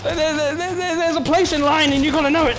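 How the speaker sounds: high, loud, flustered protest; panicked complaint. Real, not acted. Player scolds queue-jumping zombies that keep coming, panicked. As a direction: complain loudly while being overrun.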